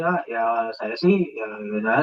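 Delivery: low, soft, slurred "ya, ya, ya"; mumbled, poor articulation. Shown as a demonstration. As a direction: mumble "Ah, ya saya sih" as if gargling the words. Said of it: "jangan ngomong kayak kumur-kumur".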